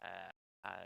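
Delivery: very faint, level turned right down; too quiet. Real, not acted. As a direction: speak as if the mic gain dropped away. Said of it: "you've gone down twenty D B".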